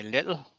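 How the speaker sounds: "little" with a short i in the first syllable; short I vowel. Shown as a demonstration. Asking which store name is right, short-i little. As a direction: say "little" to rhyme with middle. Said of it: "I pronounced Lidl wrong as well".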